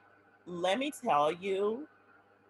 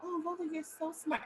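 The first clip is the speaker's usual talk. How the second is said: higher, softer, sweet praising tone; mentor voice; 8 semitones higher, 5 dB quieter than usual. Retold or imitated. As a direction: coo it like a proud mentor. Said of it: "one of my mentors was like".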